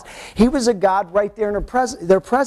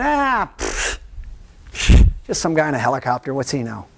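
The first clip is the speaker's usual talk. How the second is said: dismissive scoff; 6 semitones lower than usual. Retold or imitated. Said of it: "and going".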